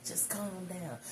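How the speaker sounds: high-pitched imitation of a calming dad; child's dad soothing. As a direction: imitate him pleading for calm in a high voice. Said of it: "He said".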